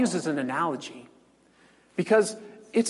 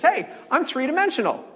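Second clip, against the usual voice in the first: cheery creature voice; 10 semitones higher, 4 dB louder than usual. Retold or imitated. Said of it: "The three-dimensional creature says".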